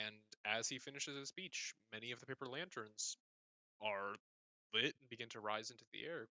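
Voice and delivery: soft, measured narration with long pauses; game master's scene setting. A narrator's reading. Game master describing the festival at the palace, hushed narration. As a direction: narrate the scene softly, phrase by phrase.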